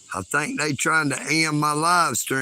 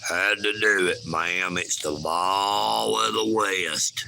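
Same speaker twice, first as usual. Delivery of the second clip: put-on Texas accent; 6 semitones lower than usual. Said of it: "Love them there Texas accents".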